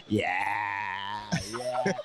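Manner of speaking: low, soft gloating yeah. Retold or imitated. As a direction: mimic a smug low gloat. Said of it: "and then Rich goes".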